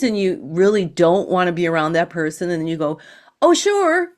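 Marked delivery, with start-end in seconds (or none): 3.4-4.1 much higher, bright mock yes while not meaning it; hammed-up insincere agreement to a friend's invite; fake cheerful yes. Fakes agreeing to the movies.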